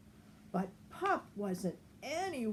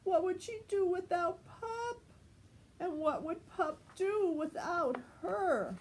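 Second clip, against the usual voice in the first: crying; 8 semitones higher than usual. A narrator's reading. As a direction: let the voice break into tears. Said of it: "Penny sat down and cried".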